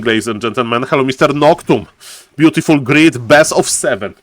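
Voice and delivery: normal talking voice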